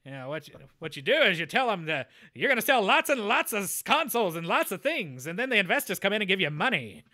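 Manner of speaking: put-on loud, fast old-school radio announcer. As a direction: boom it out like a radio host. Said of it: "I had to break in the old radio announcer voice".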